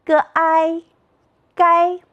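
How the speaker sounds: "g i gai" chanted high and level, g, āi, then gāi; pinyin blending. Shown as a demonstration. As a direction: sound out "g i", then join as "gai", all first tone. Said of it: "再读一遍".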